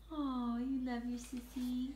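ordinary talk

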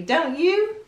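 usual speaking voice

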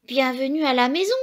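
put-on overjoyed hen calling her baby home; joyful cry. A narrator's reading. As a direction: cry out with delight to the chick. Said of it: "s'écria".

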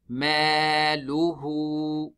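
ماله with the waw held two counts, very slow; stretched waw. Shown as a demonstration. Teacher demonstrating the two-count stretch on ماله. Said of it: "We stretch it حركتين".

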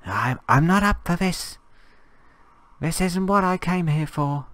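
high, loud put-on whimper of fear; mock panic. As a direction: whine it like a scared little kid who wants to go home. Mock panic at a sea monster.

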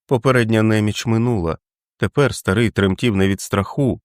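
ordinary delivery

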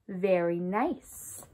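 ordinary talk

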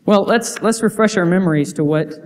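normal talking voice

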